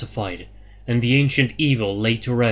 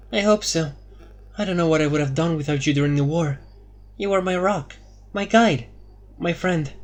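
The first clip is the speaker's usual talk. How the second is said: Anya, grateful; 5 semitones higher than usual. A narrator's reading. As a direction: thank her friend as Anya.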